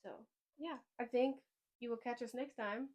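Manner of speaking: ordinary talk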